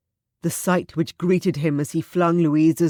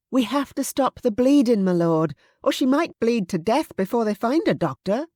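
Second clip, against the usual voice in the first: higher, insistent maid's voice; urgent plea; 8 semitones higher than usual. Acted. Susan insisting they stop the bleeding, urgent. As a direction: push him to act.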